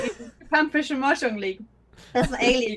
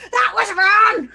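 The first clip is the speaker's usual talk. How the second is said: a hammed-up chef yelling, higher and louder; furious celebrity chef shout; mock Ramsay shout; 6 semitones higher, 5 dB louder, much slower than usual. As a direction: bark it like an angry TV chef. Gordon Ramsay shouting at player.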